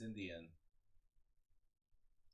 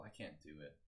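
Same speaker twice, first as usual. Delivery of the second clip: ordinary talk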